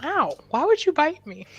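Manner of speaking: ordinary delivery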